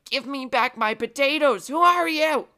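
put-on high, loud shrieking old woman; high, loud shriek of an elderly woman; old lady yelling. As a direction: screech it like an outraged granny. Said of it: "The elderly woman was like".

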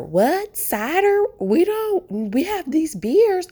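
high, loud mock bartender voice, baffled. Bartender's high mock reply to a cider request. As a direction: squeak it out like a clueless bartender.

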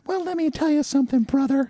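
goofy cartoon wrestler voice; Hulk Hogan impression. Guest mocks Hulk Hogan to the host in a high put-on voice. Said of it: "He actually talks like this".

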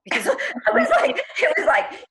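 high laughing burst. Real, not acted. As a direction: laugh through the words. Laughs while retelling.

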